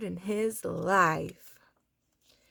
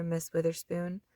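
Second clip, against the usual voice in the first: plain reading voice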